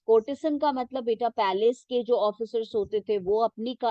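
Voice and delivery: ordinary talk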